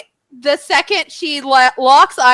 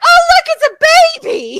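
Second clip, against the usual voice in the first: much higher cooing voice with a laugh; baby talk; 3 dB louder than usual. Retold or imitated. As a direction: coo at the puppy. Cooing at the dog.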